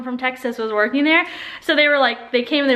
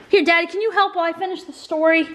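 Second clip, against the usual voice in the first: ordinary talk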